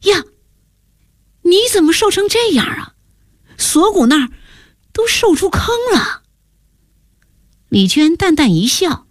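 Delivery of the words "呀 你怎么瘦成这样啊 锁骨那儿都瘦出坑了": much higher-pitched shocked question; astonished. A narrator's reading. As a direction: voice leaping high in alarm at a friend. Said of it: "我吃惊的问". (吃惊地高声问)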